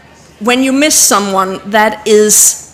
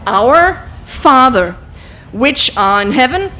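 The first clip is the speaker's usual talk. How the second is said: slow, deliberate prayer words while signing; slow prayer recital; slower than usual. Shown as a demonstration. Teacher leading the group through the prayer, slow and deliberate. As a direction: recite each word slowly as you sign.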